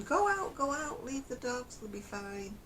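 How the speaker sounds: high-pitched quote of a son; urging son. Retold or imitated. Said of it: "My son's saying".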